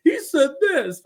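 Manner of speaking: high whiny complaining voice; whiny tattletale. Retold or imitated. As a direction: tattle like a child. Whiny feuding lord quoted.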